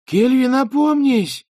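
high, slow, loud cry of protest; exclaiming. Acted. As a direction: shout her back to her senses. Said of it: "воскликнула она".

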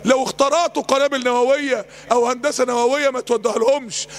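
normal talking voice

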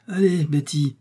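ordinary delivery, nothing marked

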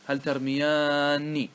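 ترمياني as tarmiyaanni, very long aa and nn; emphatic nun. Shown as a demonstration. Teacher emphasises the dual, drawing ترمياني out to long aa-nni. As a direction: sustain ترمياني slowly before the final ni. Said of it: "ثم تؤكد".

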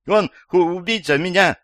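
high, loud stammering alarm; panicked. A narrator's reading. Old woman tells police a killer is after her, panicked. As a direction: stumble over the words in high-pitched panic.

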